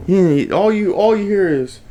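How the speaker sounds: normal talking voice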